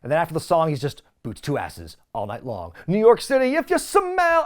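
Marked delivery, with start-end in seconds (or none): 1.2-2.7 low, soft catchphrase imitation; understated low promo catchphrase; The Rock. Imitating Rock's repeated catchphrase. 2.9-4.4 high, loud mock sign-off; hammed-up arena call; The Rock. Imitating Rock's sign-off catchphrase.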